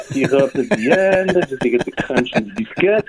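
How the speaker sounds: slow, hammed-up French accent. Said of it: "speak with the French accent".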